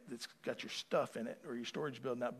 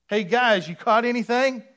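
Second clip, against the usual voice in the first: higher, loud, breezy call; mock casual greeting; 9 semitones higher, 15 dB louder than usual. Preacher mocking the casual greeting Jesus did not use. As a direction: toss it off like a buddy. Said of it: "he did not say".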